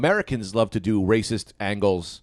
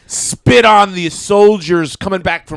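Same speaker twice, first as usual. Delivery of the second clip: mock American loudmouth; 4 semitones higher, 7 dB louder, slower than usual. American Rick character bit.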